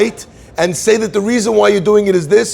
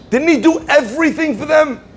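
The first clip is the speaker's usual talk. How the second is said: pitched way up, incredulous; soaring rhetorical question; 10 semitones higher than usual. Acted. Preacher asks the congregation, pitched way up in disbelief. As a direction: throw the question high in disbelief.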